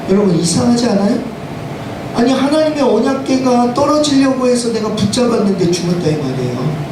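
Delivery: ordinary talk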